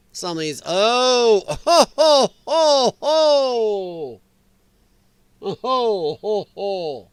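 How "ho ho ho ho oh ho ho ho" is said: amused ho-ho chuckle dying away; laughing ho ho. Real, not acted. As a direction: laugh it out in rhythmic hos that trail off. Laughing at a crude tweet.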